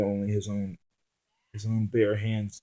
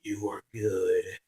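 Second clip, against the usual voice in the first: grudging respect through a growl; a hint of a menacing growl; 2 semitones lower, 4 dB quieter, slower than usual. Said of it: "said with a growl".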